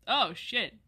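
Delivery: ordinary talk, nothing marked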